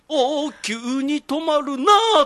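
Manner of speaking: 高く張り上げ、ゆっくり伸ばすツッコミ; 語尾の「なー」を大きく引き伸ばす; 大げさなツッコミ. Acted. ツッコミの正しい例を実演. (漫才風に声を張ったツッコミ)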